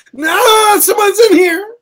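high, loud wailing shriek; hammed-up frightened yell; panicked stall scream. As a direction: shriek in mock terror at an intruder. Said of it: "I wanna be safe in my space".

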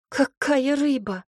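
high, tearful slow wail; sobbing. A narrator's reading. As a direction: sob out the question. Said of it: "всхлипнула она".

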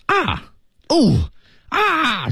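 high, drawn-out mock yelps; imitated cries of pain. Man imitates American crying out during a massage, mock yelps. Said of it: "就叫声啊".

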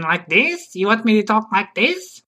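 silly put-on voice, stretched and rising; funny voice. Shown as a demonstration. Guest demonstrates a funny voice for the host, silly. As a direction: do a daft comic voice. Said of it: "You can do a funny voice".

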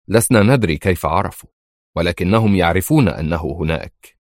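ordinary delivery, nothing marked

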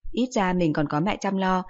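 plain reading voice

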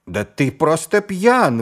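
slow, high and loud accusation; a thief crying out in scorn; loud scornful outburst; indignant exclamation. A narrator's reading. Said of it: "воскликнул господин Боггис".

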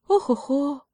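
high mouse sigh; lightly voiced little mouse, sighing. A narrator's reading. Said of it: "вздохнул там мышонок, так тихо".

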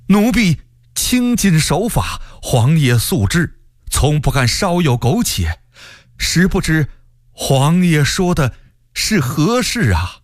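grovel before the emperor in alarm; alarmed, high-pitched pleading; panicked servant. A narrator's reading. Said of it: "曹化淳大惊失色".

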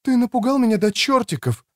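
high woman's voice, only nominally whispered; woman's voiced stage whisper. Frightened woman to the man releasing her, high voiced stage whisper. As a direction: play a startled woman, pitched high. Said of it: "прошептала она".

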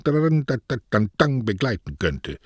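tan tan sung out, the first high; mimicked guitar strum. A narrator's reading. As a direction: hum the strums of a guitar trio. (Gitarrenschläge, gesungenes Tan Tan)

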